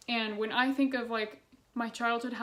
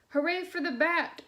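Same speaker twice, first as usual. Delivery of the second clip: bright shout, a bit higher; witch shouting; 6 semitones higher than usual. A narrator's reading. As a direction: call it out like a cheering witch. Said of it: "shouted the witch".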